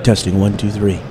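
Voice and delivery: low-pitched radio guy mic check. Pretend. Testing the mic for the show, low radio guy voice. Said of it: "Do I sound like a radio guy?".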